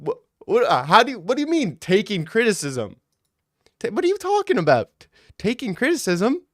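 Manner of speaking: high, squeaky disbelief; baffled. Retold or imitated. Streamer retelling his reply to a troll, squeaky baffled disbelief. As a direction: act baffled by the insult, pitch climbing. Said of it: "I'm like".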